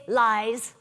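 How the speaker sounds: normal talking voice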